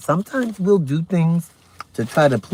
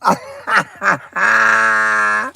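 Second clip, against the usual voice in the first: high giggle; 7 semitones higher than usual. Real, not acted. Laughing at her own song.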